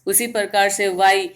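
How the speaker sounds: ordinary talk, nothing marked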